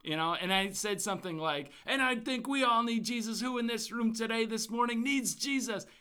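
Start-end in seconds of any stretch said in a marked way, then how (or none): 1.8-5.9 high, ringing gospel preacher call; preacher calls out to the congregation, high and ringing; Southern gospel preaching. Retold or imitated. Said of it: "preaching in the Southern, like, gospel preaching".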